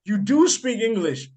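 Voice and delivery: drawn-out knowing oh; catching her out; mock surprise. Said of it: "I was like".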